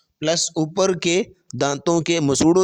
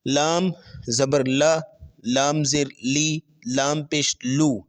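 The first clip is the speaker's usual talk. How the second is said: slow drill; slower than usual. Shown as a demonstration. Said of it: "چار steps میں".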